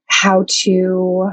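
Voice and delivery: ordinary delivery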